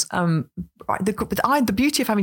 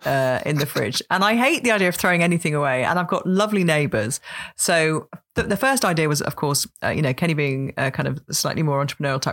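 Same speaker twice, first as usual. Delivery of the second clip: normal talking voice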